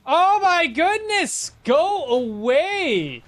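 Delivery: high, loud and stretched-out cry; slow, exasperated plea to the pestering NPCs; exasperated shooing. Real, not acted. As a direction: drag it out, fed up. Shooing hostile NPCs away.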